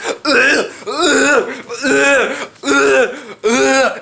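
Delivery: drawn-out hammed-up groans; mock dying groans. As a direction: groan slowly like a stabbed jock. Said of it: "He goes like this".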